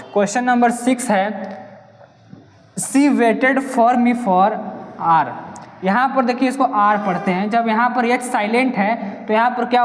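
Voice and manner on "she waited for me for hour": slow question read. Shown as a demonstration. Reads question slowly.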